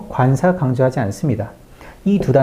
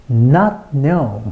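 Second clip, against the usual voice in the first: falling stress on "not" and "know"; strong emphasis. Shown as a demonstration. Teacher picks out the stressed words, strong "not know". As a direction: give "not" and "know" each a big beat. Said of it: "여기만 크게 강조하시면 됩니다".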